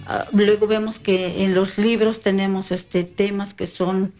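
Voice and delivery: normal talking voice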